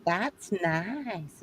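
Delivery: reply sweetly in a Southern drawl; Southern accent. Acted. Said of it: "I like your Southern accent".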